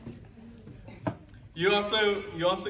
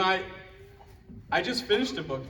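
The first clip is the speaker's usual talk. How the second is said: usual speaking voice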